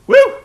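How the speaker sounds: loud high whoop. Real, not acted. Vlogger reacting to a wrestler's looks with a loud high whoop. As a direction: let out a leering cheer.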